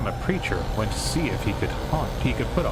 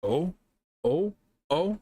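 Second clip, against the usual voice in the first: usual speaking voice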